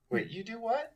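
high-pitched baffled questioning; puzzled disbelief. Acted. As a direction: react as if you can't believe what you heard. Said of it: "cocking my head at one side and going".

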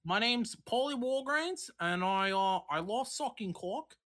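muddled put-on Australian accent; badly faked Australian with a Boston tinge. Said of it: "It turned into fucking Australian accent right there".

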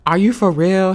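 loud, high incredulous question; shocked disbelief. Retold or imitated. Telling the DMV man she can't believe it, loudly incredulous. Acts out her shocked reply.

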